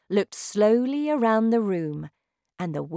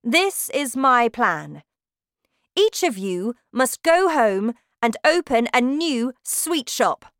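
shouting witch; 7 semitones higher, 8 dB louder than usual. Acted. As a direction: bellow like a terrifying witch queen. Witch explains her plan.

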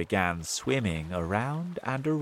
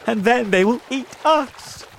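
high, loud voice of dread; alarmed cry; 10 dB louder than usual. A narrator's reading. Miss Spider warning her friends, alarmed cry. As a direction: cry out in acted panic. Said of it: "cried Miss Spider".

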